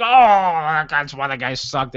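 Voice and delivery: put-on partisan whine, easing off; mock anti-Reagan griping. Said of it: "anti-Reagan people be like".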